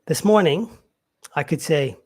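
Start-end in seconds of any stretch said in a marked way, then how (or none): none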